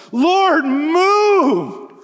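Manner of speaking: high, loud, slow, pleading cry; Isaiah's anguished plea, stretched out slowly; crying prayer. Retold or imitated. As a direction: beg God to move. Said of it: "He's praying".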